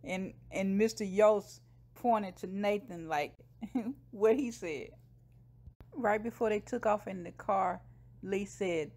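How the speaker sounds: ordinary delivery, nothing marked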